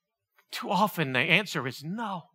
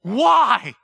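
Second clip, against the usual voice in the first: pleading with God; 7 semitones higher, 5 dB louder, slower than usual. Retold or imitated. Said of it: "And I'm going".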